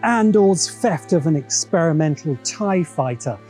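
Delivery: ordinary talk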